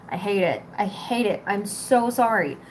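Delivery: ordinary delivery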